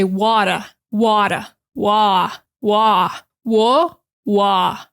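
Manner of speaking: Boston "water", wide open mouth, no final r; Boston accent. Shown as a demonstration. Teacher showing students the Boston way to say "water". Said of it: "In Boston, they're gonna open it up".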